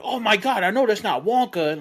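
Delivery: retold ignorant outburst; ignorant moviegoer. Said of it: "he's just being fucking ignorant".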